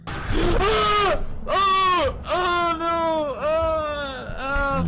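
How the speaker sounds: loud, high frightened cries; real panicked screams; terrified screams. Real, not acted. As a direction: cry out under attack. Screaming as monster attacks.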